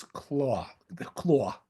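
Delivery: real Long Island accent slipping out on "claw". Real, not acted. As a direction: let a Long Island drawn-out "claw" slip out. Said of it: "That's my Long Island accent coming out".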